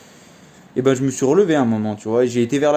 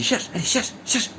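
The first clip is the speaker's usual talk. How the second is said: dog command; 10 semitones higher, 5 dB quieter than usual. Retold or imitated.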